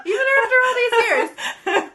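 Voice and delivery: high, squealed words through laughter; laughing protest. Real, not acted. Friend reacts to being called messy, laughing protest. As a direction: protest in a high voice while cracking up.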